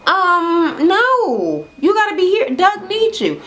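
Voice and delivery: high, sugary turn-down; high mock-gentle no; high fake-sweet refusal. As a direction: let her down with fake sweetness. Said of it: "She was like".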